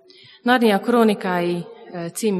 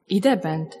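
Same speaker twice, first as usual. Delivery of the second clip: ordinary talk, nothing marked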